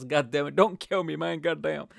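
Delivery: mock pleading. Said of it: "he's gonna kick my ass".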